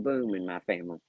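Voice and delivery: ordinary talk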